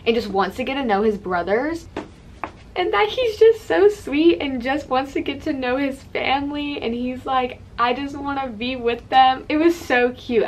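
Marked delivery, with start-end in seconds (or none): 2.7-10.4 higher and louder, swooning delight; breathless fangirl swoon; gushing over him. Real, not acted. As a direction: gush about how sweet he is. Gushing over sweet character moment.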